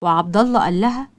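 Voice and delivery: usual speaking voice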